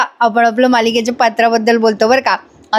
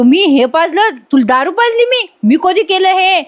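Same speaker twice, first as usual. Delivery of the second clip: high, shocked denial; 7 semitones higher than usual. Acted. Anna, accused by Dattya of serving liquor, protests in high disbelief. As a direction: pitch shoots up in disbelief.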